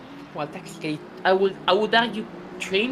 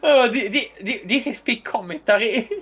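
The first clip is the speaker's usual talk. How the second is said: talking through laughter; laughing; 5 semitones higher than usual. Real, not acted. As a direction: laugh while getting words out. Laughing at his own bit.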